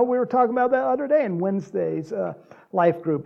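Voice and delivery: normal talking voice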